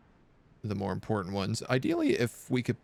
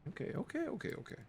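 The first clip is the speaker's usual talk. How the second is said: high, soft soothing coo; calm the squirming cat sweetly; 13 semitones higher, 11 dB quieter, faster than usual. Real, not acted. Streamer soothes cat climbing his shirt, high soft coo.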